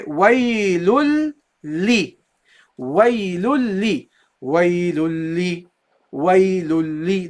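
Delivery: "waylul li" slowly, l held across the join, no n; no noon. Shown as a demonstration. Teacher drills it for the guys, doubled lam. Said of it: "There is no noon in pronunciation".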